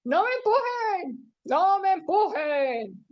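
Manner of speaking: slow, stretched cry of protest; mock shouting. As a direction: call it out long, starting high. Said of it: "El ángel Gabriel gritaba".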